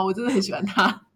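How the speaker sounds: ordinary talk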